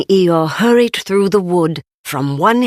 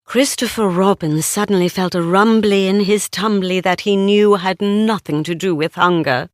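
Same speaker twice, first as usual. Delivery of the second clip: plain reading voice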